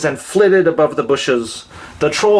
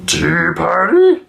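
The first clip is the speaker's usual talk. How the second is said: shy troll question; 3 semitones higher, slower than usual. Acted. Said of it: "a troll shyly peeking".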